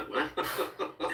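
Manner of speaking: high-pitched, laughing mock incredulity; incredulous little yelp breaking into laughter; amused disbelief. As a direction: retell your bewilderment through a laugh. Retold disbelief at kid's excuse.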